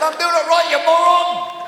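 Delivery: high, loud furious shout; angry yell. Retold or imitated. Pastor on the roundabout yelling at a tooting driver, angry shout.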